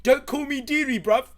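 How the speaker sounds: loud, high-pitched furious protest; angry chav. Acted. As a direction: yell it like an offended street tough. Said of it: "got really angry".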